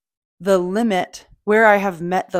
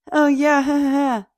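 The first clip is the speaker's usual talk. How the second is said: mocking quip; 7 semitones higher than usual. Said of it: "And they're being all".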